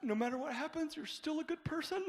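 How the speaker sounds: soft, high self-soothing; positive affirmations. Retold or imitated. Young comic reassuring himself under heckling, soft and high. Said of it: "doing positive affirmations in my head".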